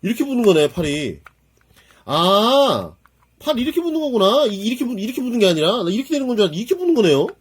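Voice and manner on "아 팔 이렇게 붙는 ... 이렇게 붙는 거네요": excited discovery. Real, not acted. Realizing how the arm attaches.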